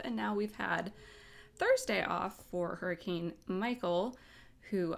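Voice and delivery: ordinary delivery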